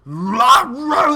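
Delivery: loud, high snarling dog imitation; mock rabid dog roar. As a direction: bark it out like a crazed rabid dog. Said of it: "And it's still".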